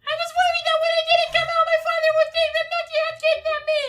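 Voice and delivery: very high, fast squeaky voice; princess voice. Acted. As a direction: rattle it off like a frantic princess. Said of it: "that is her voice".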